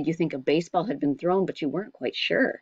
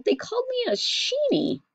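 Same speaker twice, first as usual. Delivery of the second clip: put-on much higher, wounded child voice; hurt boy. A narrator's reading. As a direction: tell mother what they called him. Boy complains to mother.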